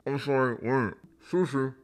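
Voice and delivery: high, loud put-on voice; mock contestant voice. Recapper imitates her reply to the proposal, mock contestant voice.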